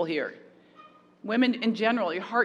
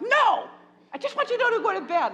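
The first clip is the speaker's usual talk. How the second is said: put-on much higher, louder denial, snapping at the kids; defensive shout; 11 semitones higher, 5 dB louder than usual. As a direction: shout a flustered "No!" at the kids who caught you. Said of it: "I'm like".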